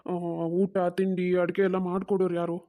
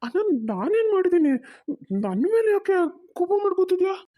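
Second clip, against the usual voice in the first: hurt pleading; 10 semitones higher than usual. Acted.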